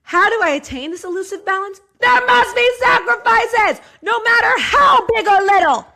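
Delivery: high, loud hammed-up proclamation; mock all caps shout. Rereading the diary line aloud as a shout. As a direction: boom it out like a motivational speech. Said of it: "All caps".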